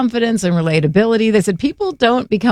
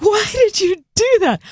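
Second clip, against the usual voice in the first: high-pitched, appalled imitation; hammed-up scandalized question; mock shocked reproach; 12 semitones higher than usual. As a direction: imitate an aghast onlooker, pitched way up. Voicing the shocked churchgoers' look.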